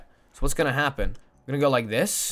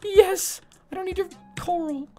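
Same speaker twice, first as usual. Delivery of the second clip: much higher, bubbling with glee; giddy excitement. Real, not acted. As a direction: squeal it to chat, barely containing it. Excited about the coming tree.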